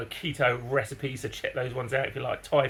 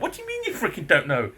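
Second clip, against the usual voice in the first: angry disbelief; 8 semitones higher than usual. Retold or imitated. Confronting neighbour.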